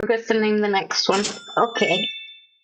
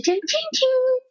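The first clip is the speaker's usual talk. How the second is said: high squeaky voice. Acted. Recording animal voice lines.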